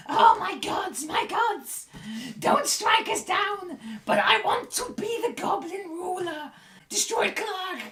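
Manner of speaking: very high goblin shriek; pleading goblin. Acted. Goblin on the ledge begging its gods, shrill pleading. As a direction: cry out to the gods in a squeaky voice. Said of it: "The goblin up here immediately stops".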